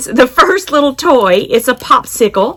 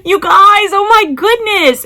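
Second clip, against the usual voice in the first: excited squeal; 10 semitones higher, 4 dB louder than usual. Real, not acted. Gushing over cute toy unboxing.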